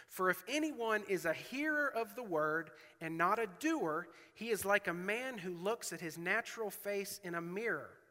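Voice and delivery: preacher reading James aloud; scripture reading. Acted. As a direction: read the verses with weight. Reads scripture again.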